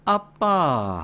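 Gyeongsang intonation, high then sliding down; drawn-out Gyeongsang call; Gyeongsang dialect. Shown as a demonstration. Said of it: "경상도에서는".

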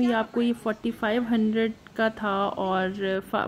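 ordinary delivery

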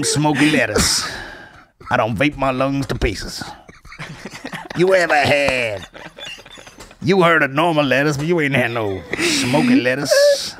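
slow put-on persona boasting; alter ego voice. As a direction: play it like a cocky character hyping his lettuce. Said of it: "my fucking alter ego".

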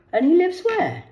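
high, loud pointed question; nosy question. Retold or imitated. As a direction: demand where.

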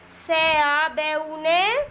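slow letter-by-letter dictation with pauses; spelling out slowly. Shown as a demonstration. Teacher spelling a word for young children, slow dictation. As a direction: draw out each letter sound for learners.

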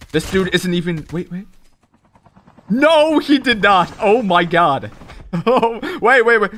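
frantic, loud and high; panicking gamer. Real, not acted. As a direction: shout in panic mid-fight. Frantic fight.

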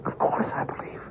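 awed breathless whisper; hushed whisper before the visitor. Acted. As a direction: breathe it out in wonder. Awed at alien's return.

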